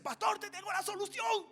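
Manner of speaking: high, loud, triumphant announcement; excited friend. Retold or imitated. Friend Otto telling the pastor he found the fix, excited. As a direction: play the friend bursting with good news.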